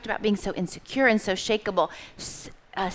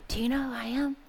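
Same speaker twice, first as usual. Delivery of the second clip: mama bird asking, softer; 4 semitones higher, 4 dB quieter, faster than usual. Retold or imitated. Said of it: "He's like".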